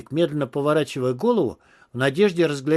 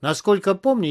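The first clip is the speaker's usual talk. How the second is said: usual speaking voice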